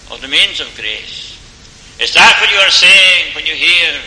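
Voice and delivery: loud, rising pulpit appeal with hammered words; full-force preaching. Acted. Preacher pressing the congregation about their cry, loud and urgent. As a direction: preach it out hard, pressing the question home.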